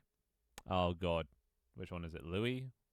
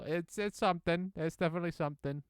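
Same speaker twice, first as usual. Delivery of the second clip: sarcastic high voice; 10 semitones higher, 5 dB louder than usual. Real, not acted. Sarcastic remark about the game.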